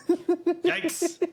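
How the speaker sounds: high squeaky yikes; mock yikes. Real, not acted. Cohost bracing for rapid fire questions, high mock dread.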